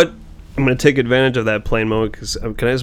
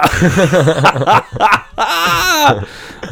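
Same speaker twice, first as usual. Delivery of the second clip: loud laughter; 8 semitones higher, 3 dB louder than usual. Real, not acted. Laughs at movie moment.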